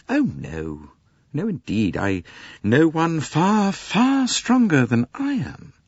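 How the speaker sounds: a hint of guarded composure; lightly voiced young Englishwoman. Mary deflects Poirot's compliment, lightly voiced and cool.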